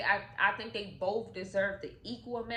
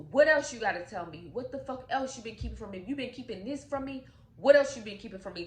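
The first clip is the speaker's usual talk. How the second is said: louder, fast angry demands; furious interrogation; 2 semitones higher, 5 dB louder, much faster than usual. Retold or imitated. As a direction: fire the questions at her. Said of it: "How about he goes".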